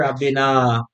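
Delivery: usual speaking voice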